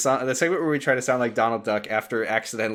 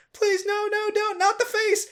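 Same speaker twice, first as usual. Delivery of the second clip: high pleading. Acted. Marley begging as Xemnas attacks.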